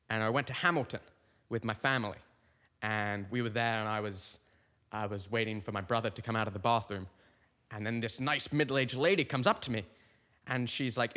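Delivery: usual speaking voice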